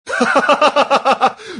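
high, manic battlefield laugh; burst of laughter. A narrator's reading. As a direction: laugh out loud like a crazed fighter. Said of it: "il éclata de rire". (éclat de rire dément et aigu)

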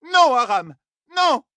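high, loud horrified scream; anguished cry. A narrator's reading. Grandmother sees the sword in her grandson's hand, anguished cry. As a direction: cry out as if stabbed. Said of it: "Ila vit l'épée et cria".

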